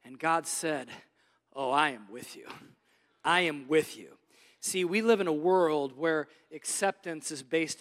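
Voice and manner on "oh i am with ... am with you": lower, tender reassurance; gentle voice of God. Acted. Preacher voicing God's answer to David, low and tender. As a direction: hush it like a comforting father.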